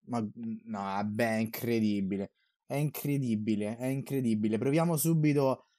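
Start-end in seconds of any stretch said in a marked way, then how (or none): 0.1-4.6 quiet, low, deflated; dejected. Real, not acted. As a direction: let the air out of it. After conceding late goal.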